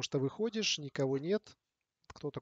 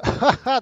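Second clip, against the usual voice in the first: loud laugh; 10 semitones higher, 15 dB louder than usual. Real, not acted. Streamer laughs at teammates. (громкий смех)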